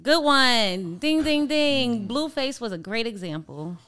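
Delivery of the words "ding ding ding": sung-out ding ding, higher and louder; playful bell imitation, higher and louder; game-show bell. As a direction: chime like a quiz bell. Signals a correct answer.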